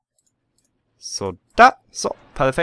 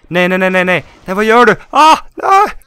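high, loud panicked no-no-no; yelling in alarm as guests go under; panicked shouting; 9 semitones higher, 12 dB louder than usual. Real, not acted. Guests drowning in the pool. (panikslagna skrik)

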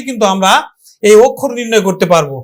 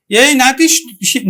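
ordinary talk, nothing marked

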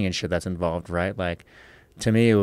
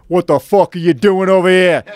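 booming, high-pitched New York yell; loud, hammed-up New York boyfriend voice; New York tough guy; 11 semitones higher, 13 dB louder than usual. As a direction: imitate a big angry New Yorker bellowing. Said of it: "dude with New York accent, super deep voice".

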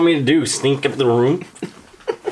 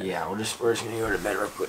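softer, quickly hushed; 8 semitones lower, 11 dB quieter, faster than usual. Acted. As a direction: keep it down, others are sleeping. Hushed bedtime talk.

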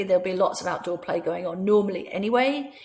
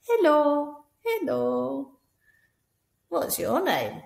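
high puppet voice; squeaky toy greeting, higher; 8 semitones higher, much slower than usual. Acted. Teacher voicing a puppet for the nursery. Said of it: "make up a little dialogue between them".

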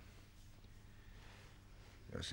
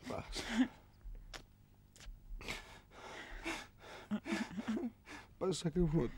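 much higher, cracking as he breaks down; voice breaking. Acted. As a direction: let it crack into a strained wail. Juanjo breaks down. (voz quebrada de angustia)

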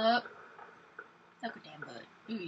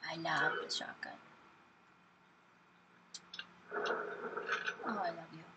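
whispering; 5 semitones lower, 5 dB quieter than usual. Real, not acted. Whispering love to shotgun.

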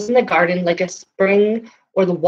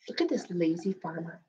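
whisper; 14 dB quieter than usual. Real, not acted. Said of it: "gasps, aside to the kids".

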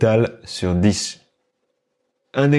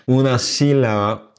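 "sílaba" with a strong first syllable, SI-la-ba; accent sur si; 3 semitones higher than usual. Shown as a demonstration. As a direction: hit SI in "sílaba". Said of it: "On va accentuer le si". (« sílaba » accentué fortement sur SI)

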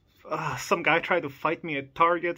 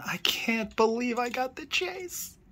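awed; 8 semitones higher than usual. Real, not acted. Disbelief over the rare find.

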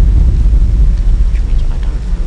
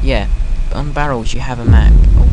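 ordinary talk, nothing marked

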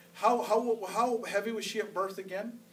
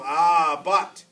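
lightly voiced enemy, loud drawn-out objection; enemy creeping in; 3 semitones lower, 8 dB louder than usual. The enemy creeping into the mind, loud mock objection. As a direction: butt in loudly with a doubt. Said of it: "The enemy tries to creep in and go".

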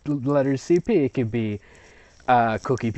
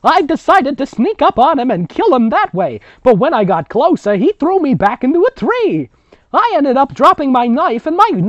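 put-on high, loud over-the-top old woman; grandmother storyteller; 12 semitones higher, 10 dB louder than usual. Grandmother telling the girls about the ghoul, high and loud. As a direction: belt out the grandma's tale with relish. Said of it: "That's the grandmother".

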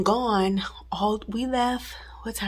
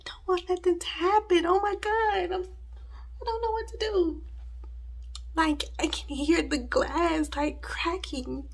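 on the verge of tears; 9 semitones higher than usual. Real, not acted. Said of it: "on the verge of tears".

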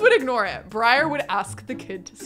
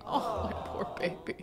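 pitying whisper; 9 dB quieter than usual. Acted. Pitying embarrassed daughter.

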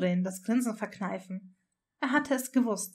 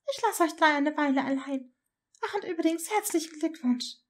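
lightly voiced mother, raised pitch; breezy parting words in a higher register; mother's voice; 6 semitones higher than usual. Mother leaving the new parents alone, breezy congratulations.

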